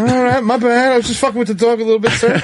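high, fast, mock-apologetic kangaroo voice; sheepish kangaroo. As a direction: backpedal fast in a high silly voice, like a caught animal.

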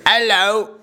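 high-pitched put-on British hello; British accent. Guest trying out a UK accent on the hosts, mock British accent. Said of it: "you better pick up an accent".

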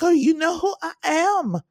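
high, loud, haughty mock self-importance; entitled socialite. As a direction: play the spoiled heiress name-dropping herself. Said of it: "Miss Lady was like".

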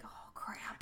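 hushed whisper. Retold or imitated. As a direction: whisper it like dread dawning. Hearing ominous music.

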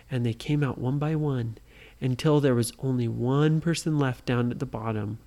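usual speaking voice

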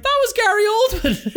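high-pitched amazed outburst; retold astonishment. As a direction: re-enact a stunned realisation, pitch leaping up. Retelling astonished reaction at film's end.

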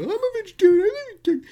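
very high whiny Texas twang; whiny Texas accent. Retold or imitated. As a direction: whine it like a Texan. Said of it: "That was the Texas voice".

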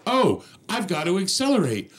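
high, bouncy voice of a clueless driver; mock confused driver. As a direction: play the driver who just got it, pitch up.

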